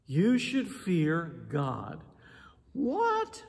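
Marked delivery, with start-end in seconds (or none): 2.7-3.4 high squeaky what; mock disbelief. Preacher voicing a congregant startled by fear God. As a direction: play the shocked listener.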